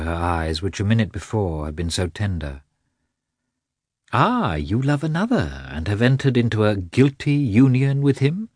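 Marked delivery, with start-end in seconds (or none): 4.1-8.5 a higher, half-mocking echo of her stiff husband, stress on guilty; mimicking Karenin. Anna mocking her husband's words to Vronsky, in a lightly mimicking voice. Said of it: "Mimicking her husband".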